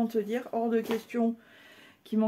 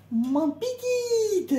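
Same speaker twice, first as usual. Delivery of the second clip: very slow, much higher stretched coo; slow, high "Ma petite."; drawn-out coo; 13 semitones higher, 4 dB louder, much slower than usual. Acted. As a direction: stretch the word out fondly.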